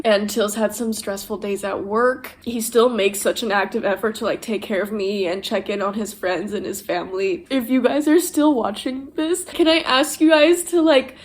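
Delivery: normal talking voice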